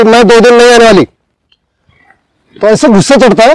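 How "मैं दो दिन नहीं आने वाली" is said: a little higher, quicker mock of a curt maid; blunt maid. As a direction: toss it off without asking. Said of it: "मुझे ऐसे बता रही है".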